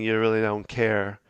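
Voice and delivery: ordinary talk, nothing marked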